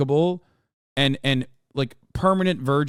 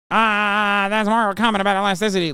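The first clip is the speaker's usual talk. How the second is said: higher, louder, fast sneering mimic of a know-it-all; mocking nerdy voice; 6 semitones higher, 4 dB louder, faster than usual. Mocking a Reddit commenter, high and snide. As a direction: parrot the commenter like an insufferable nerd. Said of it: "Lol, no, it's not".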